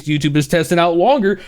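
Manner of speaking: usual speaking voice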